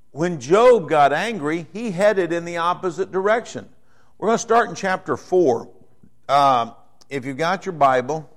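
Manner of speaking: ordinary talk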